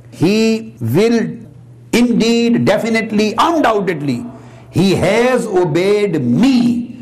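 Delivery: INDEED, DEFINITELY, UNDOUBTEDLY punched high, one by one; emphatic certainty. Shown as a demonstration. Lecturer rendering the verse's promise to the obedient, emphatically. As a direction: declare it word by word, leaving no doubt. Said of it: "given by three emphasis".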